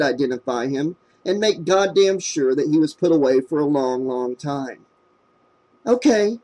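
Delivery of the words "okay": a touch of forced courage; lightly brave answer; girl agreeing to face the suspect, mildly brave. A narrator's reading. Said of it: "she answered bravely".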